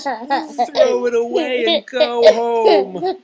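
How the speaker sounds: high, loud, slow jeer broken by laughter; laughing robins. Acted. Robins laughing Toad home, loud high jeer. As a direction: cackle it out at Toad. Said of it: "said the robins".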